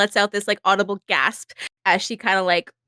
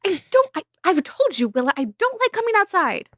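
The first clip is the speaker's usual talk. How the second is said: higher-pitched complaining lady; whiny Izzy; 8 semitones higher than usual. Acted. Izzy to Willa, refusing the outdoors, high whiny voice. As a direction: whine like a pampered young lady.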